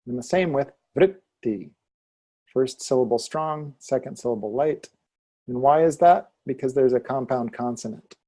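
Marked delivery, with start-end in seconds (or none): none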